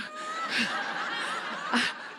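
a silent, wheezing laugh at herself; almost soundless high laugh; breathless laugh. Real, not acted. Laughs at her own story.

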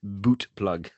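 "Boot plug" with a long oo; joke mispronunciation. Shown as a demonstration. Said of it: "mispronouncing for a laugh".

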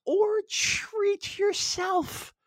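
slow, very high and stretched; high drawn-out squeal. Acted. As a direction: squeal it out slowly. Drawn-out ad catchphrase.

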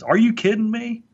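imitating the mentor's attention-grabbing challenge; incredulous mentor. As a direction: toss it back in mock disbelief. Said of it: "he uses a phrase to get people's attention".

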